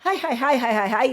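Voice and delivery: mock Japanese interviewer nodding along; eager Japanese boss. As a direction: rattle off "hai" like a pleased interviewer. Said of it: "Aí ele".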